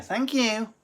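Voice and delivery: very high sing-out thanks; Lindsay. Retold or imitated. Thanking when there's no one there, high mimicking voice. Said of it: "Lindsay thanks herself".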